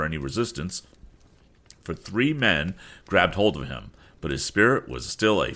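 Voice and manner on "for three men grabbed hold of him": plain reading voice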